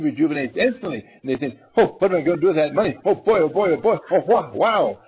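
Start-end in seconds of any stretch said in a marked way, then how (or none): 1.8-5.0 fast, loud, high giddy gushing; mock giddy delight. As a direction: gush over the imagined money. Patient imagines the money.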